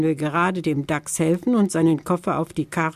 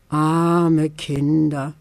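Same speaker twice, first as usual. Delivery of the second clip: very slow, pitying sigh of a voice; full of pity; much slower than usual. Acted. Tante Törtchen pities the children on their salad diet. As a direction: feel sorry for them, slowly. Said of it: "meinte Tante Törtchen voller Mitleid".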